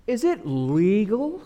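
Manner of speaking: LEGAL drawn out slowly, rising high; stress on LEGAL. Acted. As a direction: lean pointedly on "legal" as a question. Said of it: "when people emphasize words".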